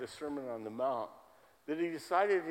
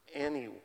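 Anyone set apart and leaned on, alone after a pause; stress on anyone; 2 semitones higher than usual. Real, not acted. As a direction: hit Anyone as its own emphatic word. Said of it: "I can't emphasize that word enough".

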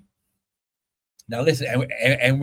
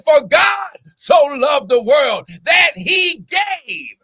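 preaching at full force; 6 semitones higher than usual. Acted. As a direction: drive it home like a fired-up preacher.

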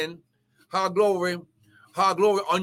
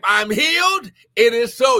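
high, loud faith declaration; fervent shout; 3 semitones higher, 6 dB louder than usual. Real, not acted. Preacher telling viewers to type healing, fervent shout. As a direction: proclaim it forcefully for all to repeat.

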